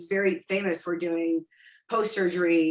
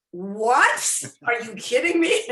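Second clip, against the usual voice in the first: loud, laughing disbelief; delighted disbelief; 4 semitones higher, 5 dB louder, slower than usual. Real, not acted. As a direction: react like you can't believe the compliment. Mistaken for her husband's daughter.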